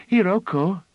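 put-on high, loud excited child's call; eager child crying out a name; gasping cry. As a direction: call out breathlessly like an eager girl. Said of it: "she cried out gaspingly".

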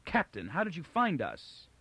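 high, amazed question; gasped. A narrator's reading. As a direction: ask in gasping astonishment. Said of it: "Beta gasped".

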